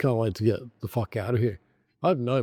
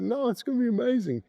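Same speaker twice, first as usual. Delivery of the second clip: high sing-song cheerful voice; mock gushing; 12 semitones higher, faster than usual. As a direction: imitate the gushing optimists, high. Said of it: "And everyone's like".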